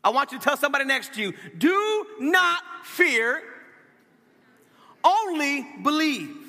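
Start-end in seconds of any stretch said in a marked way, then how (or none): 1.6-6.4 "Do not fear" raised high, word by word; long pause, then "only believe" slow and emphatic; emphatic command. A narrator's reading. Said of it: "Jesus says it emphatically".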